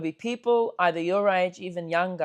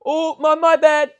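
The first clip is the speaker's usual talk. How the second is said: higher, louder mock-sheepish apology; hammed-up apologetic stranger; 9 semitones higher, 10 dB louder, slower than usual. Stranger apologizes to the lads, hammed-up and higher. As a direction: overdo the sorry like a reformed tough guy.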